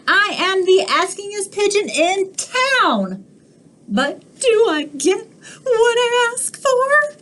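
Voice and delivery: high, whiny pigeon nearly crying; a hard-done-by bird wailing; self-pitying cartoon pigeon. Pigeon complaint.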